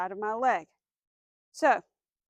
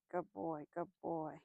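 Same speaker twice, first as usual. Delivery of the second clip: much softer, tender praise; 6 semitones lower, 12 dB quieter, slower than usual. Real, not acted. Trainer praising her dog, much softer. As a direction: murmur praise to him.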